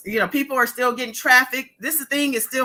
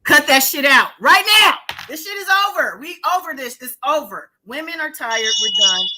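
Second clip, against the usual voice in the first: loud, much higher angry declaration; louder, higher, fed-up anger at abusers; furious outburst; 5 semitones higher, 5 dB louder than usual. Real, not acted. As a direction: slam the door on it, loud.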